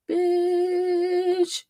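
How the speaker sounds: high, sustained exclamation; drawn-out astonishment. Real, not acted. Streamer discovering the actual lawsuit, drawn-out astonishment. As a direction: stretch the word out high in amazement.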